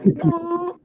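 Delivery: very high, sustained hoot; high held vocal sound effect; imitated noise. Storyteller sounds out the moment after the taunt, high hoot.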